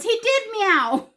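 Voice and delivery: high, slow and loud, a mock-insistent husband; husband insisting. Husband insisting the found cat meowed, slow and high. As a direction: stretch it out high and loud like a playful protest. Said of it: "He goes".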